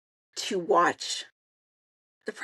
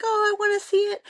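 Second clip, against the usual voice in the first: much higher, eager little squeal; excited daughter; 13 semitones higher, 3 dB louder, faster than usual. Retold or imitated. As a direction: imitate a thrilled kid begging to see the pet. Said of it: "She's so excited".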